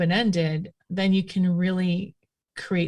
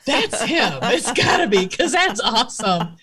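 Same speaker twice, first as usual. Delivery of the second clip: higher, louder thrilled quote; excited recognition; 4 semitones higher, 4 dB louder than usual. Retold or imitated. Recalling finding her Scottish ancestor, excitedly reenacted. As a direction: burst out like you've found the treasure. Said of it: "and I'm like".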